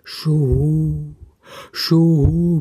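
a hooting owl call, voice changed. A narrator's reading. Owl arriving at the mitten, hooting. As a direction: hoot like a cold owl. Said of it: "Da kam die Eule".

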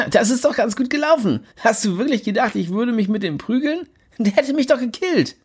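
high smug chuckling voice; gloat, pleased with yourself. Acted. Jimmy smug.

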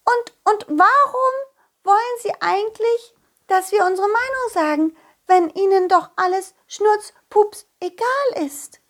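a little fairy asking defiantly; high fairy child voice. Acted. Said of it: "sagte die Fee dann".

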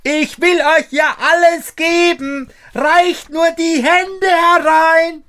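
very high, loud yell; loud high cry for the maid to hear; shouting. A narrator's reading. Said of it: "schrie Daumestick noch einmal, so laut er konnte".

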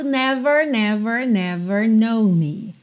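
NEVER with a clear American final R; rolled-R never. Shown as a demonstration. Teacher shows students the other option, curled R. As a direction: curl the R at the end of NEVER. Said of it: "cantar com R enrolado".

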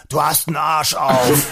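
slowed-down mock shout, drawn out; retold shout. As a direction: imitate an indignant man yelling an insult. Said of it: "Und da schrie er". (nachgeahmtes, gedehntes Schreien)

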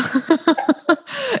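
high-pitched rising laugh; high burst of real laughter; amazed laugh. Real, not acted. Host laughs after saying wow.

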